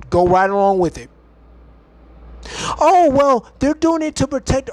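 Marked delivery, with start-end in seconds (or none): 2.8-4.7 mock naive citizen. Vlogger mocks compliant Americans in a higher sneering voice. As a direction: parody a gullible citizen. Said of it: "what did the American people go".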